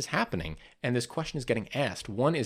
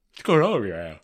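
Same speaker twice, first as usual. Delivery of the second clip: talking through a yawn; words stretched by a yawn; 6 dB louder, faster than usual. Real, not acted. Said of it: "Why am I yawning so much?".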